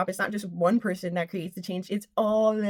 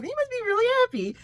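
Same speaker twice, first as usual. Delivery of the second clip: delighted; 12 semitones higher, faster than usual. Real, not acted. High fast delighted.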